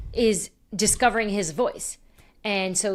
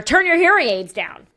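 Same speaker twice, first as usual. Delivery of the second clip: louder, higher mock yell; imagined comeback, loud and high; 7 semitones higher, 7 dB louder than usual. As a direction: yell it at the old lady. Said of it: "I wish I yelled it".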